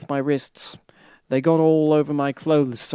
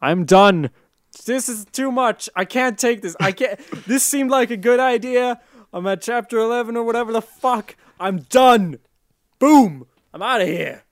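high-pitched, fed-up outburst; overwhelmed rant, bursting out high; exasperated giving up; 8 semitones higher, 4 dB louder than usual. Real, not acted. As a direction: quit in melodramatic exasperation. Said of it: "That's the sound of me giving up".

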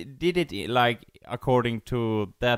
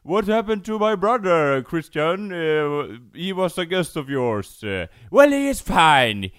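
pitched up, smug and loud; 3 semitones higher, 6 dB louder than usual. Retold or imitated. Voicing the king.

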